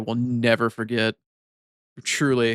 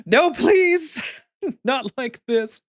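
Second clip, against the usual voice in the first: much higher, laughing plea, slowing down at the end; mock pleading; much slower than usual. Streamer pleading as her bot times out a viewer, mock horror. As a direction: beg in theatrical horror. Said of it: "It's like".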